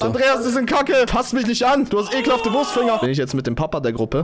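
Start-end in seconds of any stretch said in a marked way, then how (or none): 0.0-3.0 indignant protest. Pretend. Protests at being grabbed.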